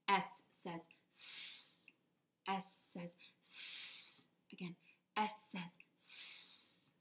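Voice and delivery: slow, whispered S says s; snake hiss. Shown as a demonstration. Teacher leading the class in a whispered snake hiss. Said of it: "makes that s sound".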